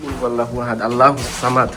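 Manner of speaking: soft, steady recitation of verses; murmured prayer. Real, not acted. Ghost hunter softly recites verses in the haunted room. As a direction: recite for protection, low and even.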